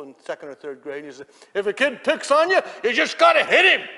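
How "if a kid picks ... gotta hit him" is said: loud, high, hammed-up dad; mock parental holler; shouted fatherly advice. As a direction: yell advice like an overbearing father.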